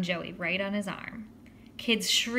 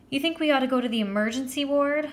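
put-on high, fussing girlish concern; overdone girlish alarm; fawning girl; 6 semitones higher, 4 dB louder than usual. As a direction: worry over him sweetly. Judy fusses over Joey's sting.

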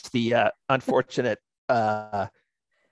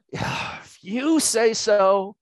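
put-on very slow, higher, sulky teenage reply; grudging, drawn-out sigh of a line; 9 semitones higher, much slower than usual. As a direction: drag it out like a put-upon teen. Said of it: "he always goes".

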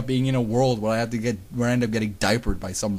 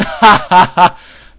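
loud high burst of laughter; real high, loud laugh; 8 semitones higher, 15 dB louder than usual. Real, not acted. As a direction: crack up loudly in disbelief. Reacting to absurd story detail.

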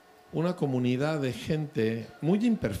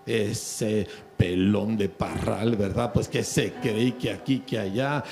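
mock gossip; 5 semitones lower than usual. Gossiping about Alan to Alma.

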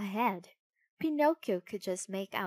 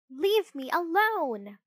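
high, loud, drawn-out protest; crying out; 8 semitones higher, 7 dB louder, slower than usual. A narrator's reading. Pinocchio to nagging cricket, crying out in protest. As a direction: cry out to be left in peace. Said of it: "Pinocchio cried".

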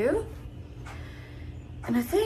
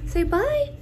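"Say bye?" cooed much higher with a rising lilt; mother cooing "Say bye?" high to her son. Real, not acted. Mother prompting baby to say bye.

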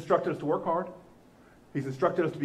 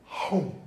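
a lazy, drawn-out chomp at usual level; slow-motion dog gulp. Retold or imitated. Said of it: "the dog goes".

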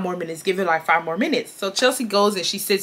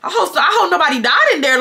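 retold worry, higher pitched; stammered, higher-pitched mock worry; worried; 7 semitones higher than usual. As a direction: act out rising concern. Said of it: "I start getting worried".

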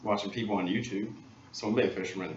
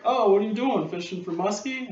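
mock bystander voice; 12 semitones higher, 4 dB louder than usual. Guy asks mockingly about muskie.

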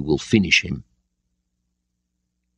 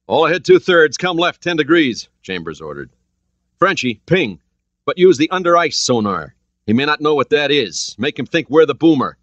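crisp captain orders; 8 semitones higher, 4 dB louder than usual. A narrator's reading.

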